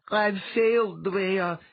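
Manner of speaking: high, loud, despairing cry; sobbing. A narrator's reading. Letha confesses failure to Dwea, sobbing. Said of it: "Letha sobbed".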